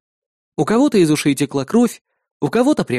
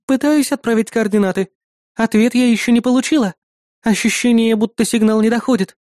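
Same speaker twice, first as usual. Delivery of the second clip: higher-pitched assistant reporting a problem; female AI assistant; 7 semitones higher than usual. Acted. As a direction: report status as the hero's helper.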